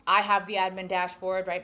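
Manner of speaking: ordinary talk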